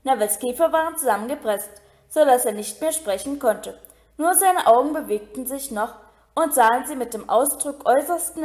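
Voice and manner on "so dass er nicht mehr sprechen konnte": usual speaking voice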